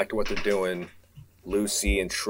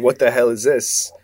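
confused, raised exclamation; baffled; 4 semitones higher, 7 dB louder than usual. Acted. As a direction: blurt out the confusion. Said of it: "He's like".

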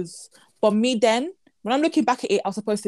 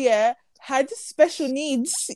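hesitant; 3 semitones higher, much slower than usual. Real, not acted. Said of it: "I feel like I shouldn't even be saying it".

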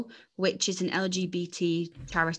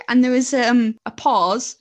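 ordinary talk

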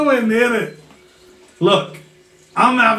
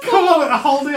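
normal talking voice

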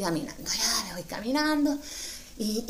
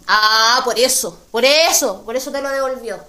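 much louder, smug know-it-all; loud knowing remark; 9 dB louder than usual. Retold or imitated. Bus driver explaining why, loud smug certainty. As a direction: declare it like you've solved it.

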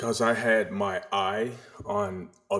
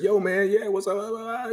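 much higher, louder, hyped-up buddy greeting; 14 semitones higher, 6 dB louder than usual. Retold or imitated. Imitating how he talks with certain friends, loud and high. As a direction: greet an old friend all pumped up. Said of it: "I've got different voices for".

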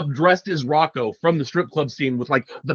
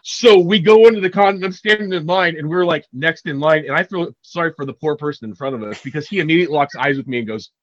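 ordinary delivery

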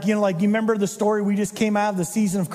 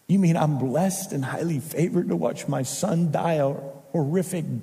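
grieving mother; 7 semitones lower than usual. Retold or imitated. Grieving Mary at the cross.

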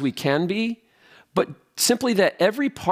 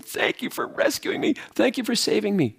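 mock weeping thanks; 6 semitones higher than usual. Rescued man on his knees to his rescuers, acted weeping thanks. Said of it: "he's weeping".

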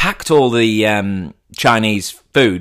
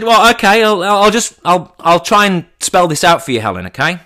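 louder and higher, patience wearing thin; exasperated; 8 semitones higher, 5 dB louder than usual. Retold or imitated. Telling Helen her plan won't work, exasperated and louder. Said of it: "And I said".

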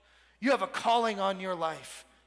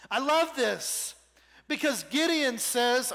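ordinary talk

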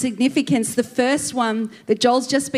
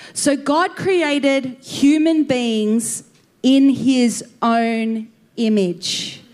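slow scripture read; much slower than usual. Real, not acted. Reads scripture slowly, solemnly.

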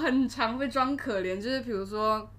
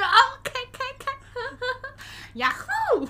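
much higher, laughing whoop; gleeful cheer; 4 dB louder than usual. Retold or imitated. Cheering as the customer buys pricey wine, gleeful whoop. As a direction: celebrate the big sale.

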